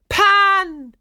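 high, loud cry for help; desperate cry. A narrator's reading. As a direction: scream out to your god for rescue. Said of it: "Chloe cried".

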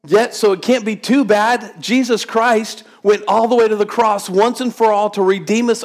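high, driving preacher's call; preaching at full force. Acted. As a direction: preach it out to the whole room. Preaching on Jesus and the heroes.

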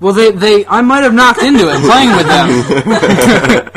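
giggling through a sheepish excuse, slow; laughing excuse. Retold or imitated. To his girlfriend about the broken thing, sheepish laughing excuse. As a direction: laugh out the guilty admission.

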